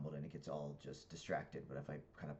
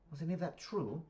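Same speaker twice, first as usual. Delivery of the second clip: put-on high UK-accented question; Pippa in British accent; 13 semitones higher, 7 dB louder than usual. Daughter questioning parent after visitor left, high British accent. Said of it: "I speak in the accents 'cause it's U K".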